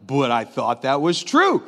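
slow, low mock-puzzled voice; dismayed listener. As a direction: put on a let-down listener, dragging the words. Voicing doubting listeners, slow and low.

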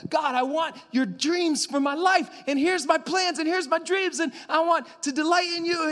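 high and loud, hammed-up earnest asking; mock-whiny prayer to God; mock eager pleading. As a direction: parody a believer pushing their own plans. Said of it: "many of us are like".

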